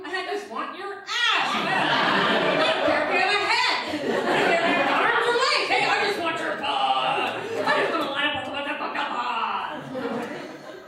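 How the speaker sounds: hammed-up crude ad reader; mock lecherous ad voice. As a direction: leer over the words. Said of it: "It's like".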